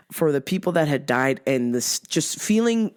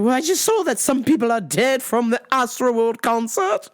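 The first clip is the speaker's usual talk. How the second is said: mom voice; 5 semitones higher than usual. Retold or imitated. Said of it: "She's like".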